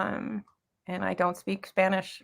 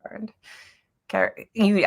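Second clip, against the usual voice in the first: ordinary talk, nothing marked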